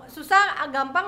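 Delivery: ordinary talk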